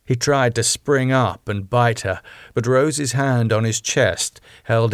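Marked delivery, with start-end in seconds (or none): none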